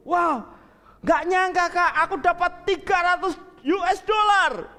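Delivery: high, loud, thrilled shout; little sister yelling news of a bonus; excited shout. Acted. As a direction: burst out with the good news. Jackie announces bonus to sister.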